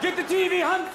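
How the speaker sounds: high, loud call; hollering. Acted. Biathlon drive-by gag, hollered high and loud. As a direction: yell it across at someone. Acting a Norwegian drive-by shooter.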